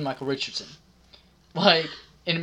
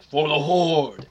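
slow, louder orc battle cry; Thrall orc voice; 5 dB louder, slower than usual. Retold or imitated. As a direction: imitate an orc warchief's rallying shout. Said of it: "his best Thrall orc voice".

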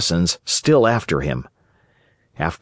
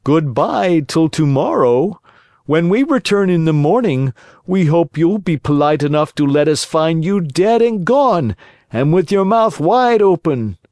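raised, sneering villain voice; mocking call; 6 semitones higher than usual. A narrator's reading. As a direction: taunt the hanging puppet. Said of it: "the assassins called to him mockingly".

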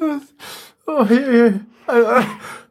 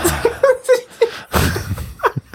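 squeaky helpless laugh; high-pitched giggle. Real, not acted. Laughing at the letter.

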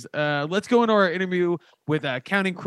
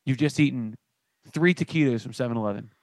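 sponsor ad read; 5 semitones lower than usual. Acted. Off-the-cuff sponsor story, low and hushed.

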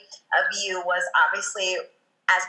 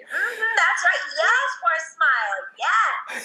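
coach cheering; 9 semitones higher, slower than usual. Retold or imitated. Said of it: "yelling out at the stage".

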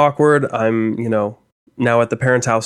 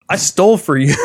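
mock heartbroken outcry; 5 semitones higher than usual. Mock outrage at cheating girlfriend.

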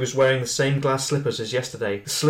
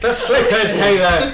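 louder hammed-up shout with a laugh; louder laughing mock shout; 5 semitones higher, 6 dB louder, slower than usual. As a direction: yell it at Kayla again, laughing. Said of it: "rereading the shout".

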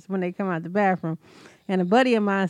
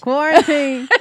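playful loud call; 6 semitones higher, 7 dB louder, slower than usual. Acted. Joking reaction to a cough.

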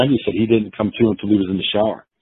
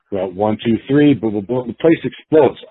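ordinary delivery

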